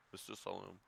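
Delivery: ordinary talk, nothing marked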